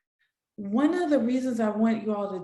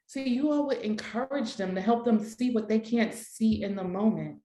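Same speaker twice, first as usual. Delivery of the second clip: ordinary talk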